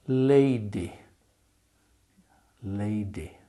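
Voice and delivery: ordinary delivery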